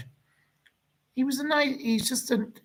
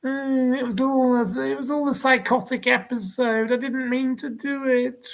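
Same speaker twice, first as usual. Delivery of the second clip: much higher, whiny mock voice; a sneering, put-on whimper of an excuse; 11 semitones higher than usual. Caller mimicking [name]'s TV excuse, much higher whiny voice. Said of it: "And you went".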